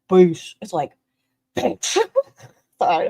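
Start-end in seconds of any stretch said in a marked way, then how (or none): 0.1-0.5 mock bass boom. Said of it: "It's not even like". 1.6-2.0 growled mock. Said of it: "a growl".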